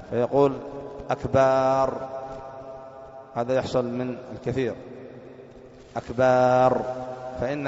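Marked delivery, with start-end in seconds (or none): 1.1-1.9 "أكبر" said as "أكبار"; long "aa" in the last syllable of "أكبر"; wrongly stretched ba. Shown as a demonstration. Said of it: "ولا يمد الباء". 6.0-6.8 "أكبر" drawn out to "أكبار"; long final syllable, "akbaar", for "أكبر"; wrongly stretched ba. Shown as a demonstration. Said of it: "ولا يمد الباء".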